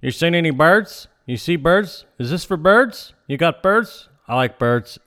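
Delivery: imitating the feed-store clerk's repeated bird questions; repetitive clerk. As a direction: pester about birds over and over. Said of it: "repetition thing".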